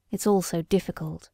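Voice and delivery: soft, low murmur to himself. A narrator's reading. Doctor alone on the doorstep, murmuring softly to himself. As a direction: sigh it out under your breath. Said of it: "he said to himself".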